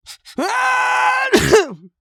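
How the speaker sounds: loud, high, long cartoon roar; monster roar. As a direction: blast it out like a monster. Monster roars at Max.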